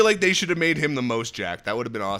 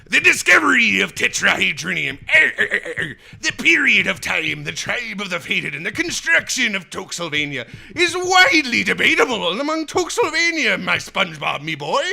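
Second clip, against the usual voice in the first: high, loud ridiculous character voice; 6 semitones higher, 5 dB louder than usual. Pretend. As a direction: ham it up in a silly put-on voice. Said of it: "like, ridiculous".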